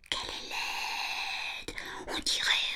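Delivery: horrified whisper to himself; chuchoté pour soi. Acted. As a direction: breathe it out in fright. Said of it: "se dit-il". (murmuré avec effroi)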